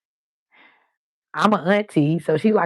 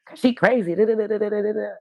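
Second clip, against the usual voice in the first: higher-pitched mimicry of a girl's dismissive chatter; imitating Rose; 5 semitones higher, faster than usual. Friend Rose explains why the girl sits alone, mimicked higher. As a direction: rattle off the friend's retort higher, trailing into da-da filler. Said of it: "so Rose was like".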